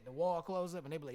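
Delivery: ordinary delivery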